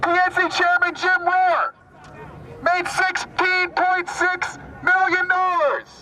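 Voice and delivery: rally speech declamation. Real, not acted. Activist addresses an Occupy rally, declaiming phrase by phrase. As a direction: declaim in short phrases with long pauses.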